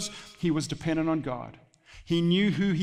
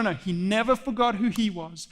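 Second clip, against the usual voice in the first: ordinary talk, nothing marked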